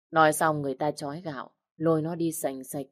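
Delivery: plain reading voice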